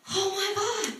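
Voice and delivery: shocked joy breaking into laughter; high astonished exclamation that dissolves in laughs; stunned delight. Retold or imitated. Woman stunned by the reveal.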